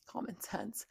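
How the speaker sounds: hushed whisper; whispered aside. Real, not acted. Venting to viewers about his lies, whispered. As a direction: drop to a whisper as if it's obvious.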